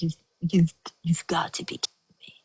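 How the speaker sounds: whispered disbelief; stunned whisper. Retold or imitated. Told no room, whispering stunned disbelief. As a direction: breathe it out in shock.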